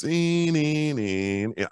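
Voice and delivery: high sung "een" copying a keyboard note; a high "een" that drops on the repeats; mock synth whine. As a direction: imitate the riff he heard through the window. Imitating music heard through window.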